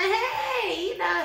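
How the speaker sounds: a hint of a fake friend's greeting; fake friendly hey. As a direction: play it off with a sugary hi. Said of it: "playing it off".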